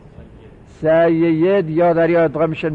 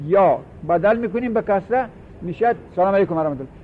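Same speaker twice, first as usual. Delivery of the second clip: normal talking voice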